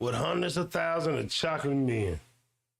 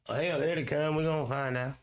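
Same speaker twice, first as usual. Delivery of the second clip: usual speaking voice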